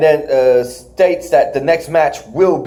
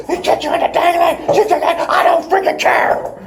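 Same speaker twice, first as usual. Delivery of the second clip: much higher imitated yell, breaking into laughter; laughing mock scream. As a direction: laugh through a hammed-up yell. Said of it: "one of them is screaming".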